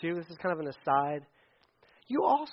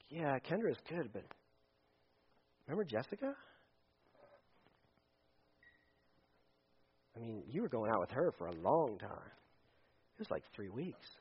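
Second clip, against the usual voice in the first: soft, low insinuating voice; tempting old self; 6 semitones lower, 13 dB quieter than usual. Retold or imitated. Old self whispering to the listener, soft and low. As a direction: coax him back to the past, hushed.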